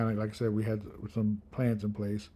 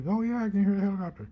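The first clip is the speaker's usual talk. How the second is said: mock excited; 9 semitones higher, much faster than usual. Pretending to hear the helicopter.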